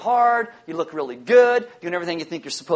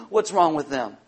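ordinary delivery

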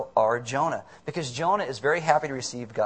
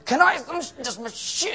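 high-pitched mock fury, stumbling; spluttering anger; 10 semitones higher than usual. Customer fighting the Coke machine, spluttering mock rage. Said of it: "and you're angry".

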